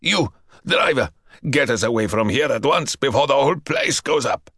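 commanding, hurried; urgent order. A narrator's reading. Ustinov urging the driver to flee, commanding. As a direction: order the driver away fast. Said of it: "Ustinov shouted".

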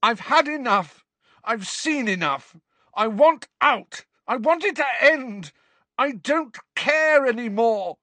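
high, loud, breaking rant; a grieving boy raging at the headmaster; angry yelling. A narrator's reading. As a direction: spit each line out in fury. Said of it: "Harry yelled at them".

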